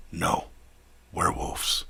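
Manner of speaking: low, quiet werewolf rumble; a hint of a beast, held back. Werewolf warns over headset.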